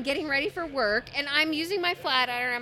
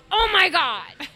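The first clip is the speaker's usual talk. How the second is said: loud, raised mock groan of protest; exasperated outburst; 5 semitones higher, 7 dB louder, slower than usual. Woman mimics partner before he rolls over, exasperated. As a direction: throw it out loudly as the fed-up partner.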